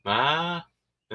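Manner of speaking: high whine for attention; imitated baby fussing. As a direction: whine like a baby not quite crying. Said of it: "like, crying".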